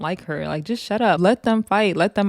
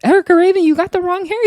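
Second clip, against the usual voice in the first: much higher, louder shouted warning; mock yelling; 12 semitones higher, 5 dB louder, faster than usual. Cast shouting at Erica Raven over the fight, high yell. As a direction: yell it across the brawl. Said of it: "They're all yelling".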